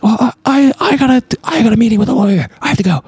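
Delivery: high, stammering mock panic; mock flustered. Imitating the guy fleeing the call, mock flustered. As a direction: stammer a hurried excuse in a squeaky imitation.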